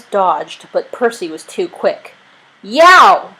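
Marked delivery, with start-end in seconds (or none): 2.6-3.3 high, loud bitten yelp; screech of pain. A narrator's reading. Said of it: "Thomas screeched".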